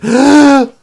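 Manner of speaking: loud acted gasp of an old man coming to life; sudden gasp. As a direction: gasp sharply, startled back to life. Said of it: "The, the old man goes".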